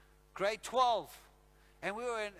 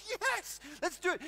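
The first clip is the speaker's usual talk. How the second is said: high, excited jump at the bet; greedy excited agreement; eager yes; 9 semitones higher than usual. Retold or imitated. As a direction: leap at the bet. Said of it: "I'm like".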